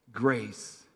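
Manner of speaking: ordinary talk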